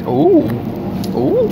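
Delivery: high excited squeal; excited ooh. Real, not acted. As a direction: squeal with delight. Excited oohs at the snacks.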